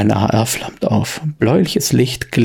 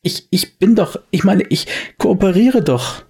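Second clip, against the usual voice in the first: stotternder Wirt, deutlich höher; er fleht, stammelnd und hoch; verängstigter Wirt; 7 semitones higher than usual. Acted. Wirt wird bedroht. (verängstigter Wirt, hoch und stotternd)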